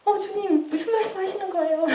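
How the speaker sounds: high-pitched mock wail breaking into laughter; retold tearful protest. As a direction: plead as if crying, half laughing. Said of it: "제가 막 울었죠".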